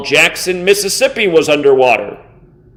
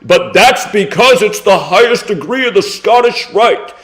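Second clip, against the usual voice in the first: mocking quote. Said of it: "Do you think you just made some intelligent point".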